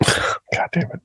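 whispered curse through a laugh. Real, not acted. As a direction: laugh it off under your breath.

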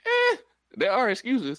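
high, loud skeptical "eh"; dismissive comeback. Acted. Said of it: "And I'd be like".

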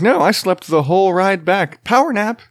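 put-on high, chirpy kid voice refusing a nap; toddler voice. Dad voicing his toddler who refuses to nap, high and chirpy. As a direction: play the wide-awake toddler.

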